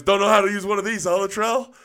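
quick, high, loud ribbing; amused sergeant teasing. Retold or imitated. Sergeant walking in on a stumped private, amused teasing. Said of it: "he laughs. He goes".

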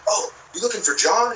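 guy asking. Retold or imitated. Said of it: "they're like".

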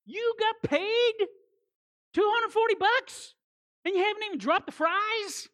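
high, loud indignant disbelief; mock the grumbling worker's outrage; outraged grumbler. Preacher acts out outraged grumbler.

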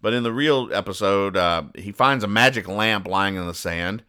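ordinary delivery

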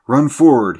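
loud, high urgent order; alarmed yell; crying out. A narrator's reading. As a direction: shout it as an emergency command. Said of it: "he cried".

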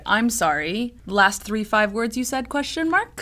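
rising questioning read-out; curious inquiring lilt; upward question. Retold or imitated. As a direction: say it like a question, tone climbing. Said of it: "He says to, to start to say".

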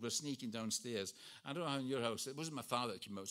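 ordinary talk, nothing marked

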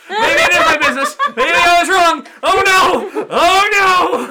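high, loud panicked cry; hammed-up panic, oh no; mock panic. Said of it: "I felt like".

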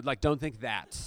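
normal talking voice

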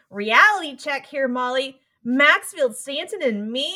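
put-on light Brooklyn accent; a hint of a Brooklyn accent, slowing down. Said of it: "she in her Brooklyn accent goes".

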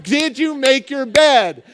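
slow, emphatic exasperated demand; exasperated parent. Acted. Parent repeating the question to a distracted son, exasperated. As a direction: stretch each word, losing patience.

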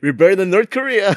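laughing through a joke. Acted. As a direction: chuckle it out. Joking boast, laugh heard.

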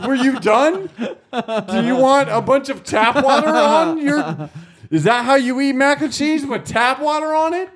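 high, incredulous comic rant; retold indignation. Challenging his date over ruined food, mock-outraged. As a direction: pile up outraged questions, pitched high.

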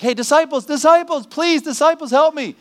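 high, loud desperate calling; woman pleading. Pretend. The woman calling to the disciples for help, high and loud. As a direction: call out over and over, pitch rising. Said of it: "So they're like".

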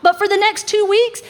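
ordinary talk, nothing marked

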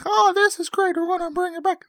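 very high falsetto mom impression; mock mom voice. Podcaster mimicking the mom from the movie, high falsetto. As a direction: squeak it out like the cheerful mom. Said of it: "That's exactly how she sounds".

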